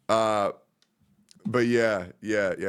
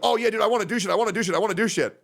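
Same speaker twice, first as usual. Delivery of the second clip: higher, eager mimicry of a friend; mock eagerness; 8 semitones higher, much faster than usual. Imitating an eager friend wanting plans, mock-eager. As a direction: imitate someone itching to do things.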